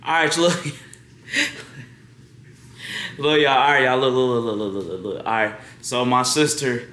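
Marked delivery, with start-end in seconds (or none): none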